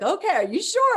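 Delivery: high, loud questioning quote; quoting him. Retold or imitated. Officiant checking if she is all right, high and loud. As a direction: ask it like a worried official. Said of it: "He's like".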